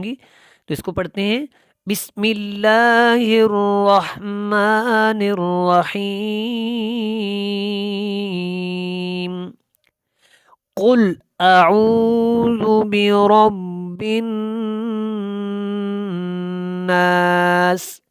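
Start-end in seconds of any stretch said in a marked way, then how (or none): 1.9-9.5 drawn-out chanted tajweed recitation; slow Quran recitation. Shown as a demonstration. Tajweed teacher demonstrating recitation to students, slow chant. 10.8-18.0 drawn-out chanted tajweed recitation; slow Quran recitation. Shown as a demonstration. Tajweed teacher demonstrating recitation to students, slow chant.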